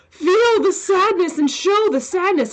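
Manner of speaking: high-pitched mock coaxing direction; acting direction, mimicked. Actor parroting what actors are told, in a high mock voice. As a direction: put on a high voice, urging the actor on.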